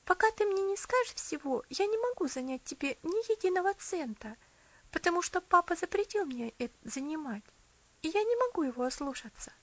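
put-on high, earnest little girl; young girl voice. A narrator's reading. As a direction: refuse gently but firmly. Girl Elsie refusing.

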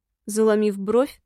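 ordinary delivery, nothing marked

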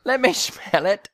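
high, badly faked English with "smell" as "shmell"; comic, mangled English accent; attempted English accent. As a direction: pitch it up in a mock English accent. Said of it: "I don't think they say sh".

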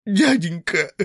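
высокий плачущий вскрик; взмолись сквозь слёзы; со слезами. Acted. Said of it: "со слезами воскликнул". (сирота со слезами кричит хозяину)

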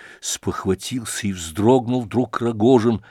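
ordinary delivery

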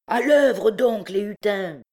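high, loud, drawn-out call; shouted rallying cry. A narrator's reading. Chief driving his wary men back to work, shouting. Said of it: "cria Trouillefou".

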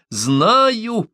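very high, drawn-out cry; yelling through mirth. A narrator's reading. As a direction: wail it out gleefully, without actual laughter. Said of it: "завопила Омаата, давясь от смеха". (весёлый вопль)

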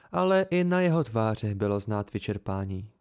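plain reading voice

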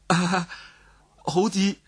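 plain reading voice

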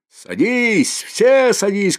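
high, loud shout inviting everyone aboard; drunken shout. Acted. Mikolka to the whole crowd, a loud high shout. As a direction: bellow the invitation across the crowd. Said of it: "кричит Миколка".

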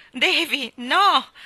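high, loud alarmed shriek; Anna shrieking at Davy, alarmed; shrill cry. Acted. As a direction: shriek it to stop him. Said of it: "strillò Anna".

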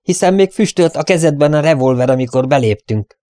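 indignant volley; thundering. A narrator's reading. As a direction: press the charge loudly and high. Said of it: "dörögte Derrick".

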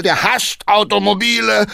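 high, loud call over his shoulder. Acted. Coachman calls back to the councillor, shouting. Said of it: "rief Hackendahl".

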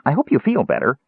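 high, kindly kender; light, friendly chirp; cheerful. A narrator's reading. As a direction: wish them well in a high voice. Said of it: "Tass said cheerfully".

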